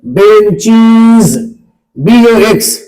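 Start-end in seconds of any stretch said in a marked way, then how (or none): 0.1-1.4 बेंचीज very slow, long held "cheeez"; drawn-out correct pronunciation. Shown as a demonstration. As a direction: stretch out the end of बेंचीज as a teacher models it. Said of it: "क्या बोलेंगे?".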